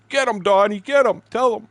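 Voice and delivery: high, loud egging-on through laughter; mock farmer cackling get him; laughing cheer. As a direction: cheer him on while cracking up. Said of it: "He started dying laughing".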